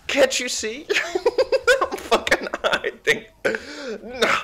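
high, loud, laughing indignation; laughing protest. Real, not acted. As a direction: squeal through laughs you're hiding. Laughing protest when spotted hiding.

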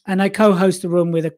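ordinary talk

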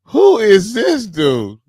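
high, drawn-out disbelief, falling at the end; amazed churchgoer. Pretend. Churchgoer stunned by the guest singer, amazed. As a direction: stretch it out in mock astonishment.

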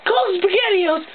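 ordinary talk

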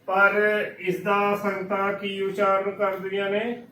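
normal talking voice

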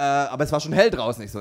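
normal talking voice

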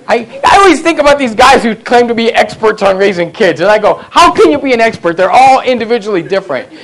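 high, loud, mock-exasperated rant; loud comic preaching. Preacher joking to the congregation about parenting experts, loud and high. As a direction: preach it big, playing it for laughs.

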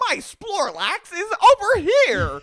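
put-on high, loud, slow cringey baby talk; mock a cringe video voice, high and loud; squealy mocking millennial voice. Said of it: "making fun of millennial cringe videos".

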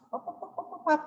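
high, soft staccato popping syllables; quick little high-pitched pup-pup-pup; rapid imitated patter. Teacher imitating a pupil dashing off an essay, high soft patter.

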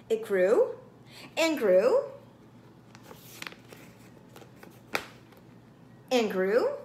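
each GREW swooping up loudly; suspenseful build. A narrator's reading. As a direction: lift and swell on every "grew". Feather keeps growing in story.

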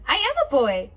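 firm. Acted. Child insists firmly.

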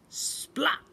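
sharp airy splat; splat sound. A narrator's reading. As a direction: say it like jelly hitting the floor.

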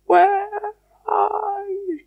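high, soft, long-drawn falsetto; thin, stretched-out faraway cry; very faint distant call. Acted. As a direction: call from far over the water. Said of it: "very faintly".

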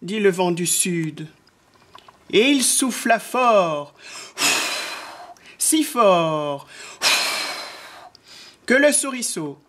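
drawn-out phrases that swoop up as things fly; children's story narration. A narrator's reading. Narrator telling how the wind blew, lilting storytelling. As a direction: tell it with suspense to small children.

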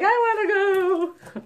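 put-on high, drawn-out child's whine; whining kid. Mom mimicking her child about the dentist, whiny kid. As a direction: imitate a kid begging to come along. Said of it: "what kid's like".

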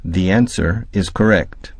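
ordinary delivery

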